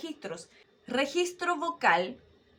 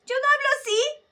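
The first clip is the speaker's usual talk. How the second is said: high voice demo; 9 dB louder than usual. Shown as a demonstration. Voice teacher contrasting a high range, much higher and louder. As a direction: jump up very high and loud. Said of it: "Hablo al medio".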